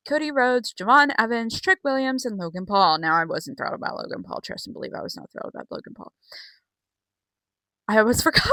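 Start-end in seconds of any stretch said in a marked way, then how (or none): 3.2-6.1 fast whisper. Real, not acted. Emphatic aside.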